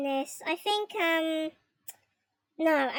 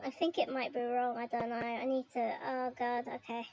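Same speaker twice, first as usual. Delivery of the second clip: softer, trailing off in worry; cringing; 3 semitones lower, 8 dB quieter than usual. Real, not acted. Streamer cringing quietly at her own mistakes. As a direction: let it fade out, embarrassed. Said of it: "I'm cringing at my own errors".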